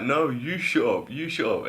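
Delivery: acted-out squabble, stressed and high; mock arguing. Acting out a retold argument.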